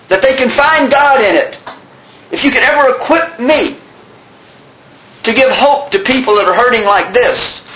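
high, impassioned prayer in measured phrases; fervent plea. Acted. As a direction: beg God earnestly, voice lifted. Retold fervent prayer for hope.

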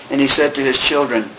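usual speaking voice

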